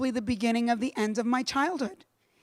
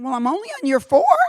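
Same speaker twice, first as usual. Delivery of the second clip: wry laugh; 2 semitones higher, 6 dB louder than usual. Retold or imitated. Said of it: "I kept thinking".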